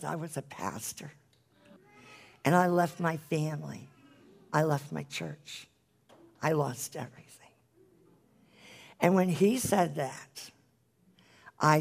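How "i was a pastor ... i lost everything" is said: soft, near-whispered confession; broken confession. Retold or imitated. Old man confesses to evangelist, soft hushed whisper. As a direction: let it fall to a hushed whisper. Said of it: "And he says".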